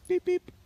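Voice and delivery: a pair of high piping beeps; a double blast to turn the dog; quick double whistle beep; two whistle beeps. Shown as a demonstration. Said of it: "use the whistle in quartering".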